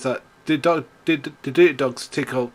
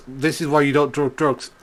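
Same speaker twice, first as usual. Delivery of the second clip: ordinary talk, nothing marked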